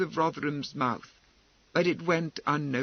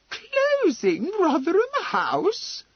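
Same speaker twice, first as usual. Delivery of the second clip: very high, loud, drawn-out disbelieving question; incredulous exclamation; 6 dB louder, slower than usual. Acted. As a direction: echo it as if you can't believe your ears. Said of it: "exclaimed Lady Laleham, as though she could not believe her ears".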